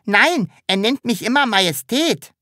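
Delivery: high, cheeky little creature voice; calling out. A narrator's reading. Sams pokes out its head to tell, calling out. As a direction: pipe up in a high voice. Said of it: "streckte das Sams seinen Kopf heraus und rief".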